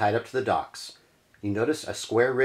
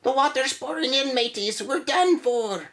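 put-on old pirate cook. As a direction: wail it like a doomed ship's cook. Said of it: "The cook pokes his head up".

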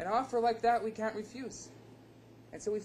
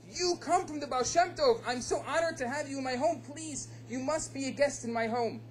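excited, higher voice, gushing welcome; delighted host; 7 semitones higher than usual. Acted. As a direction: greet the honored guest with eager delight. Host honored by the guest.

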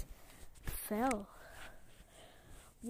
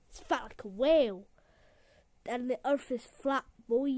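usual speaking voice

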